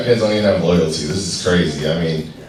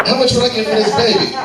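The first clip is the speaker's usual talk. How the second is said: very high, fast put-on crackhead voice; faster than usual. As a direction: rush it out high and wired. Crackhead selling baby to friend.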